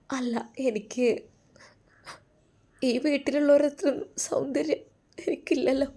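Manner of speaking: high, soft, halting wife voice; hesitant, self-doubting wife. Acted. Wife shyly admits feeling plain. (മടിച്ചുമടിച്ച്, ഉയർന്ന പതിഞ്ഞ സ്വരത്തിൽ)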